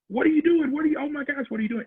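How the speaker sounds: retelling the panic from the back seat; freaking out. Retold or imitated. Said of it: "we're in the back freaking out".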